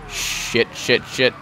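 panicked whisper mid-game; whispered curse. Real, not acted. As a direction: hiss it under your breath.

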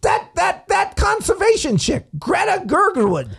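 high, eager stammering guess; goofy contestant. Pretend. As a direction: blurt out the answer like a proud kid.

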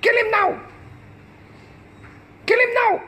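high sneering mock mob voice; mocking online mob. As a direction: ridicule him like jeering commenters. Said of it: "They're like".

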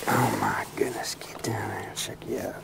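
hushed, breathless wonder; awed whisper. Real, not acted. Angler whispering in awe at his catch. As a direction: whisper it like you can't believe it.

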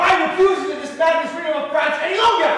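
roaring treason at the room; shouting. Acted. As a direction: rise to a furious cry. Building revolutionary shouting.

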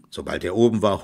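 usual speaking voice

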